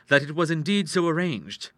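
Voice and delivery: ordinary delivery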